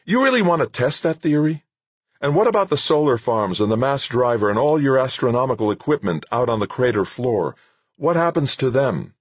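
high, loud, snappish questions; heated challenge. Acted. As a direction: fire the questions at him angrily. Director angrily challenging the scientist.

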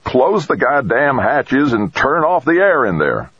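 high, slow, drawn-out yell; stretched, urgent distant yell. A narrator's reading. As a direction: yell the order to everyone at a distance. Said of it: "Jamie heard Craig yelling".

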